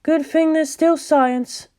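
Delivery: each word set down crisply; clipped and precise. Acted. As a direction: Ritsuko turns to science, crisp and exact. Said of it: "Her words were clipped and precise".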